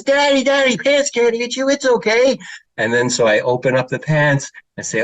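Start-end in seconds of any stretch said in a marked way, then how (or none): 0.0-2.4 put-on high, sweet little girl reassuring daddy. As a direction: play a small child calming her father. Voicing young daughter reassuring.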